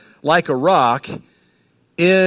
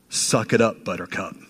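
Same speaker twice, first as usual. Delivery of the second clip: low, soft, dry tough love; a hint of a mom telling her boys to toughen up; tough-love mom line; 8 semitones lower, 7 dB quieter than usual. Pastor voicing a mom telling her boys, dry and low. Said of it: "as I would tell them".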